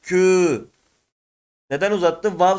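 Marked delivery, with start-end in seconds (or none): 0.0-0.6 kü held long, slow; elongated kü. Shown as a demonstration. Teacher showing students how vav lengthens kü. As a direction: draw out the ü in kü. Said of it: "Uzatırsak".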